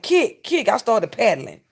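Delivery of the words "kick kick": high, loud mock shout of kick, kick; instructor's call. Retelling the class coach calling out moves, high loud shout. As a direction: bark it like a fitness coach. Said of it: "she was like".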